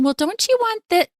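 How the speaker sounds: high-pitched judgmental leading question; mock pushy questioning. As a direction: imitate someone second-guessing, voice jumping up high. Voicing a judgmental question to reject.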